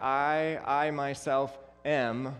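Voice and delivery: slow, halting I, I myself am; stuttered I am. Shown as a demonstration. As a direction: stretch out the repeated I like a stammer. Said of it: "it's kinda like he's stuttering".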